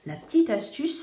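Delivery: ordinary talk, nothing marked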